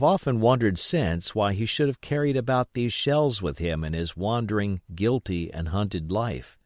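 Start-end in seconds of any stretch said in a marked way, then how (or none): none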